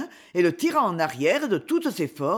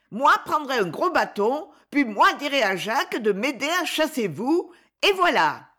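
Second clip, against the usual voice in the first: put-on higher little boy, stubborn baby talk; a tiny boy defending the cook's garden; small child voice; 6 semitones higher than usual. (tout petit garçon têtu, voix aiguë)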